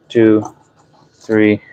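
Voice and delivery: normal talking voice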